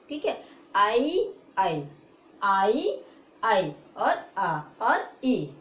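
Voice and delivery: आई said slowly and drawn out; slow vowel drill. Shown as a demonstration. Teacher demonstrating आई sounds slowly. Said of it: "आ भी पढ़ते हैं और आई भी पढ़ते हैं".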